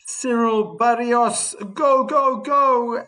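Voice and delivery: excited cheering. Real, not acted. Cheering on player in stream.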